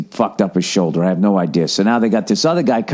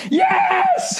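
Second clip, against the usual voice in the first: drawn-out, very high screech; exasperated scream. Retold or imitated. Caller answering the robot's last failure, screaming. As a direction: scream it at the end of your rope.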